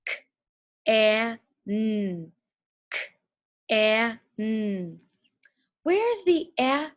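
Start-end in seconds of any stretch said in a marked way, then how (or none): 0.0-2.3 K, a, n said as separate sounds; sounding out letters. Shown as a demonstration. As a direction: split can into K, a, n slowly. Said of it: "Let's sound out the word can".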